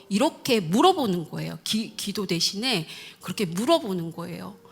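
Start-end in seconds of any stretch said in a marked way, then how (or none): none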